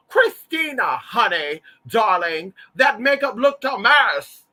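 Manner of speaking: loud, high theatrical read; Crystal LeBezier reading someone. Retold or imitated. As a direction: draw out each word as a grand insult. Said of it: "Miss Crystal LeBezier was reading the girls".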